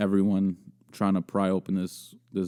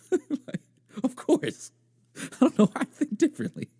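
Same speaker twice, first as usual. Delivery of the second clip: high, squeezed through giggles; laughing while talking. Real, not acted. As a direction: try to talk through real giggles. Cracking up at own story.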